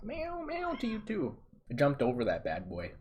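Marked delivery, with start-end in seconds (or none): 0.0-1.4 very high, slow coo to the cat; high cooing reply to a cat. Real, not acted. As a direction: sing it up high to the pet. Answers a cat.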